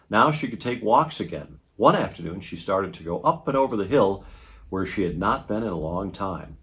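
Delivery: usual speaking voice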